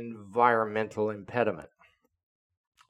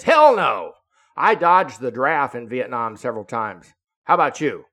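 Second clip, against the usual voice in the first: brash exclamation dodging the draft; loud boast; 9 dB louder than usual. Retold or imitated. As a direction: shout it out with brash pride. Rick boasting loudly.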